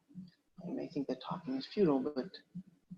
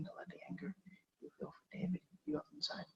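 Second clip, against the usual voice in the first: whisper; 4 semitones higher, 5 dB quieter than usual. Acted. Actor rehearsing the therapist role.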